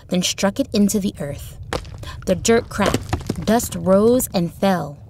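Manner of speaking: plain reading voice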